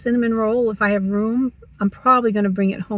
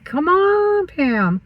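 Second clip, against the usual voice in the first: drawn-out, high "come on", dropping on the name; exasperated plea to yourself; frustrated with herself; 9 semitones higher, much slower than usual. Acted. Vlogger urging herself to unplug her laptop, mildly exasperated. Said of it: "I'm a little frustrated with myself".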